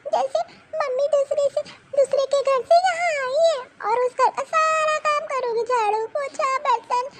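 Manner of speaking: put-on high child voice, crying while complaining; a hurt girl sobbing out everything to her mother; tearful little girl. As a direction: daughter crying to mama about brother and grandma.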